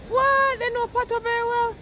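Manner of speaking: high, astonished exclaim. Retold or imitated. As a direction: squeal it out in disbelief. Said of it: "when I say".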